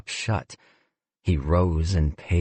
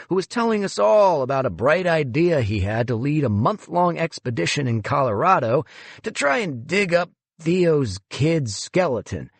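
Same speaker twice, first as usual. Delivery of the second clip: lightly voiced smug boss, higher; breezy condescending boss on the phone; a hint of a smug museum director; smug executive; 8 semitones higher than usual.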